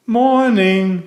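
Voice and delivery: high, bright sing-song greeting; cheerfully. Acted. Mister Clumsy greeting his cousin at breakfast, cheerfully. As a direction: chirp a happy good morning. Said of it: "said his cousin cheerfully".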